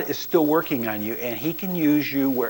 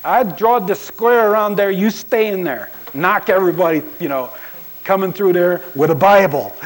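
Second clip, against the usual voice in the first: mock God command; 5 semitones higher, 5 dB louder than usual. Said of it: "It's like God saying".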